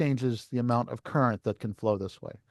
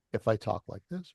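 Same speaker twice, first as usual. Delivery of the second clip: soft demo; 3 semitones lower, 7 dB quieter than usual. Shown as a demonstration. Teacher showing the class a quiet input, speaking softly. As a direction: speak it much softer, a quiet first take. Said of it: "it's supposed to get proportionally louder".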